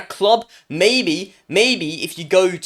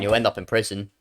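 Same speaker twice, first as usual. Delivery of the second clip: ordinary talk, nothing marked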